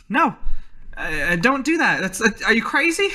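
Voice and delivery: high, stammering acted protest; flustered, pitch climbing in disbelief; alarmed objection. Elsie alarmed, trying to stop shooter.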